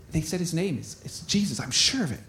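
low, soft realization; hushed certainty. Acted. Blind beggar sure it is Jesus, hushed. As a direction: say it under the crowd noise.

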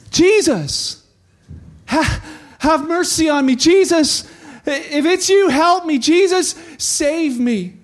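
high, loud, urgent begging; pleading shout. Acted. Blind beggar begs Jesus for mercy, shouting. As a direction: cry out for mercy over a crowd. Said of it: "he began to shout".

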